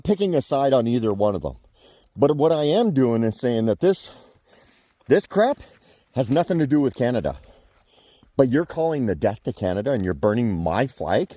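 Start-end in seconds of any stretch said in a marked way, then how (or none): none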